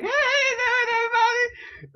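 very high, put-on squeaky voice; teasing a friend with his own squeaky greeting; mocking squeaky greeting. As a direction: mimic his silly high intro voice. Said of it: "You're like".

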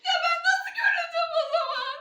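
crying shout. Real, not acted. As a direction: wail it out in frustration. Girl crying about TV.

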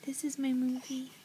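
soft tender whisper. Real, not acted. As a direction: breathe it lovingly. Whispering over the baby.

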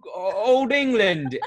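high, slow, hammed-up British accent; bad British accent from an Xbox kid; terrible British accent. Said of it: "it would be a terrible accent".

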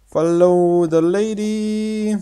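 high, very slow lady line; slow character voice. Pretend. The girl telling the player to follow her, slow.

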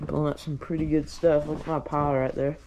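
usual speaking voice

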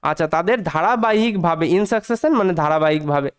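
normal talking voice

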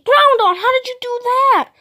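high, loud amazed praise; high loud disbelief. Pretend. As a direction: cheer at the toy in disbelief. Amazed at the win.

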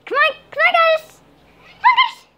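high, loud coaxing call to the cat; sing-song call to bring the cat over; squeaky "come on" to a pet. Real, not acted. Calling her cat to fetch.

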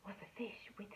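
secretive whisper. Acted. Secret revealed to children.

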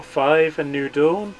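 ordinary talk

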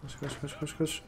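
acting crazy. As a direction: mutter it over and over like a madman. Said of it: "Deli taklidi yapıyor".

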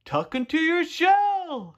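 high, slow called instruction; calling out. A narrator's reading. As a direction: call it up slowly, pitched high. Turtles shout instructions up to Tudley.

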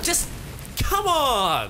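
high, drawn-out plea; exasperated outburst. Real, not acted. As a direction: groan it out in protest. Exasperated at the cutscene.

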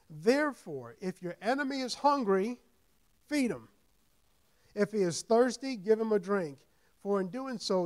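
preacher proclaiming the verse to the church; building scripture proclamation. Acted. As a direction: rise until you shout the last words. Reads scripture, loud and slow.

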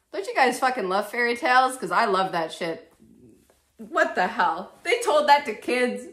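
loud ironic rant about gory fairy tales; loud sarcastic rant. Real, not acted. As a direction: shout it at the viewers, half laughing. Ranting about fairy tale gore.

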